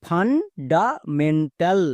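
Fundamental stretched out very slowly, each syllable ফান-ডা-মেন-টাল held apart; drawn-out teaching demo of fundamental. Shown as a demonstration. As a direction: spell fundamental out slowly for learners. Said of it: "তাহলে পুরোটা কি দাঁড়ালো?".

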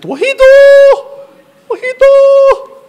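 very high, drawn-out screech; mom's accented scream. Retold or imitated. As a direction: scream it like an exasperated accented mother. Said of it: "she would scream in that voice with her thick accent".

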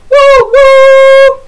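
high loud woo woo; teacher hooting as the mountain train; train whistle. Shown as a demonstration. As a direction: hoot like a steam train whistle. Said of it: "guess what the sound for this will be".

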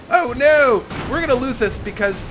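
high, loud wail of impending defeat; alarmed dismay. Real, not acted. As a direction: cry out as the battle slips away. Fears losing the battle.

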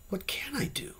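high, hushed plea; begging, almost tragic. A narrator's reading. Troubled man begging his friend for help, grave and hushed. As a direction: beg softly, nearly whispering. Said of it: "it begged".

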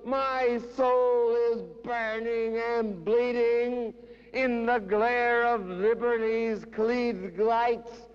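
high, impassioned cries, easing down at the end; theatrical high-pitched recital; anguished poetry declamation. Acted. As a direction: cry it out high, then slow and sink. Dramatic quoted outburst within poem.

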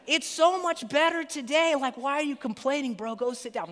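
high dismissive dude voice; dismissive barista. Retold or imitated. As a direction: wave off the customer like a chill barista. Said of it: "that's how I imagine a barista would talk".

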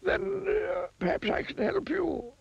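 very high-pitched wizard voice; helpful wizard. A narrator's reading. Wizard offering Mister Mean his help, helpful. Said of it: "said the wizard".

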